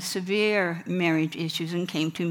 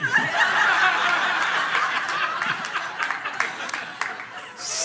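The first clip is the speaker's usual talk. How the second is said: high breathy laugh; real amused laugh; 11 semitones higher than usual. Real, not acted. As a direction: laugh at the confession. Laughing at her own punchline.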